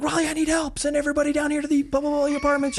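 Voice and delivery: high, fast mock-panicked call for backup; frantic radio call. Officer re-enacting his call for backup, high and frantic. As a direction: re-enact a desperate squeal for help.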